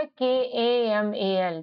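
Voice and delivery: letter by letter, slowly; slow spelling. Shown as a demonstration. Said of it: "तो क्या हो जाएगा". (धीरे-धीरे अक्षर बोलना)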